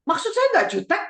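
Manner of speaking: high-pitched snippy protest rising at the end; jutek, snippy. Acted. Child denying being snippy to parent, high-pitched and jutek. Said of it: "suaranya tinggi, terus ngomongnya, eh, jutek".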